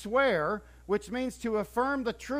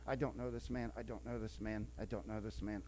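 dropped to a low mutter, much softer; muttered denial; 10 semitones lower, 12 dB quieter than usual. Retold or imitated. Peter denying Jesus to bystanders, muttered much softer. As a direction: mumble it like you want to vanish.

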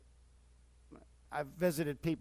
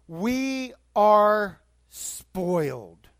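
very slow, louder, stretched out words; loud emphatic declaration; 3 semitones higher, 9 dB louder, much slower than usual. Acted. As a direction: proclaim it to the whole room. Repeated, loud then quiet.

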